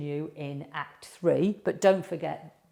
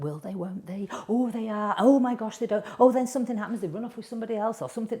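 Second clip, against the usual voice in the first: higher, gushing viewer reactions; hammed-up suspense of a film fan; mock movie excitement; 4 semitones higher, faster than usual. Film viewer reacting to a romance plot, playful mock excitement. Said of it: "you're like".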